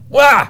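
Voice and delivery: short, high, loud mock "wah"; playful wail. Speaker gives up on explaining stress, a playful loud cry. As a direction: let out a sudden silly yelp.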